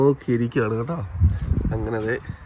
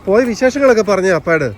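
loud coaxing; 8 semitones higher, 6 dB louder than usual. Real, not acted. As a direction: coax her loudly to share her news. Urging child to tell dad. (ഉറക്കെ സ്നേഹത്തോടെ ചോദിക്കുന്നു)

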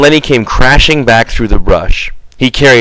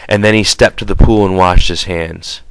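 ordinary delivery, nothing marked

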